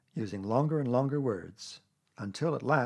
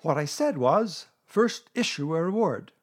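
loud, raised-pitch insistence; emphatic restatement; 5 semitones higher, 6 dB louder than usual. A narrator's reading. Owl repeating himself after being interrupted, emphatic. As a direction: repeat it firmly and loudly.

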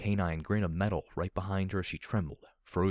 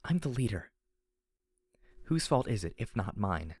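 distraught; 3 semitones higher than usual. Acted. Heroine blames herself to her companion, distraught.

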